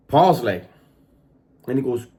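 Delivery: ordinary talk, nothing marked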